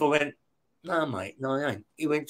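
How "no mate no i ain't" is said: soft, under-the-breath retold no; the prisoner quietly refuses the cop, soft and firm; quiet refusal.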